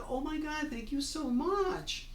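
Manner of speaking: re-enacted delighted thanks; gushing thanks. Delighted reply to a compliment, acted out.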